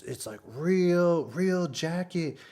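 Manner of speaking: high, slow proud mock voice; imitating the cook. Vlogger imitating the cook showing the jacket, slow high proud voice. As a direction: show off the jacket slowly and high. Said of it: "he's like".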